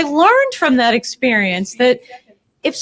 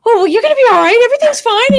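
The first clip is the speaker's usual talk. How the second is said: high, louder soothing mother voice; motherly comfort; 11 semitones higher, 7 dB louder, much faster than usual. Retold or imitated. Mother comforting a crying housemate, high soothing tone. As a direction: reassure her like a mom fixing things. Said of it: "the mother in me goes".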